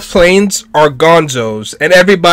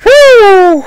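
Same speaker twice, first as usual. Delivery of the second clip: high, drawn-out woo; excited whoop; 13 semitones higher, 4 dB louder than usual. Real, not acted. Viewer cheering the cannon, excited whoop.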